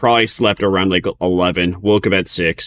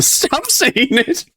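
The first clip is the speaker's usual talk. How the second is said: high, giggly laughing finish; much slower than usual. Real, not acted. Streamer recalling mocking friends, laughing. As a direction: break into laughter on the words.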